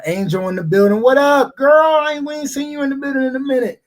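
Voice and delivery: loud, high call to a viewer by name; excited shout-out. Real, not acted. Host greeting a returning viewer, loud and high. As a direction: hype up the viewer joining.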